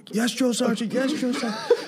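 high-pitched imitation of recruits; mock obedient privates. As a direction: answer like cowed trainees, high and quick. Privates answering the sergeant, acted.

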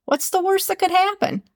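high and upbeat, eager to try; bright curious lilt; hopeful question. Shown as a demonstration. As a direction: toss it off with optimism. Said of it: "You can even tell the tone".

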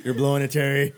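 laughing in character. Acted. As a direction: try to deliver it while cracking up. Game master cracking up in character.

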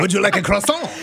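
a put-on French accent through laughter; laughing French accent; mock French accent. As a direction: play a laughing French waiter. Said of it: "in a French accent".

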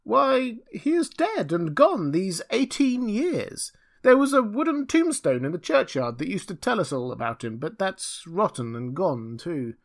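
put-on high, reedy old voice sinking at the end; thin, piping old man. A narrator's reading. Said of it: "an old man replied in a thin, piping voice".

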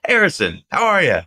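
cheery greeting. Retold or imitated. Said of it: "he just goes".

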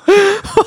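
high, loud burst of laughter. Real, not acted. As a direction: crack up laughing. Laughing at the spokesperson joke.

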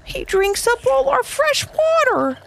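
very high, slow salamander; slow squeaky salamander complaint. A narrator's reading. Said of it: "said a salamander".

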